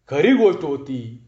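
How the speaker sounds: ordinary delivery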